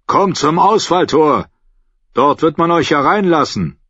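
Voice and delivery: high, loud call. A narrator's reading. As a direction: call it out like a guard. Crossbowman calls down to him.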